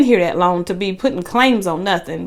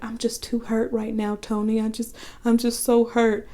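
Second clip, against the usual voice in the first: soft, wounded and much quieter; a touch of heartbroken girlfriend, softer; quietly hurt; 7 dB quieter than usual. Retold or imitated. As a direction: say it as if too hurt to speak up. Angela wounded, voiced softly.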